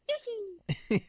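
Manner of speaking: high, loud squeaky creature cry; Yoshi. Retold or imitated. Re-enacting the outburst in the car, high creature squeal. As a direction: blurt it out in a piercingly high squeal. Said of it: "Yoshi just came out of nowhere".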